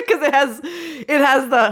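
laughing through the words. Real, not acted. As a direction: talk while laughing. Laughing while talking.

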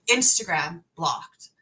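usual speaking voice

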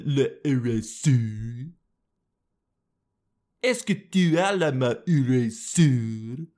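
slow, hammed-up French phrasing; mock French accent. Vlogger mocking French gendered nouns, hammed-up French accent. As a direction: ham up a fake French speaker. Acting a French eraser line.

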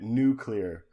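"Nuclear" said as NOO-kleer; corrected pronunciation. Shown as a demonstration. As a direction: pointedly drop the extra U from "Nuclear". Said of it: "Joe and you always give me shit".

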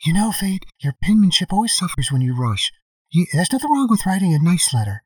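lightly voiced doting mother; nagging parent impression. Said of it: "Still working on the Fate's mom voice".

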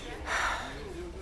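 long breathy sigh; exasperated sigh. Acted. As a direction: let out a weary, fed-up sigh. Customer asked about fries abroad.

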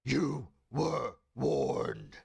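very slow, loud, booming threat. Acted. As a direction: boom it out like a god. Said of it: "so loud and booming".

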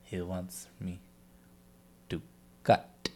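very soft, halting imitation with long pauses; quiet mock-creepy voice, word by word; possessed interviewee. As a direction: imitate a haunted patient, hushed and chilling. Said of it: "like, when he's like".

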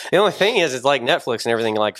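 usual speaking voice

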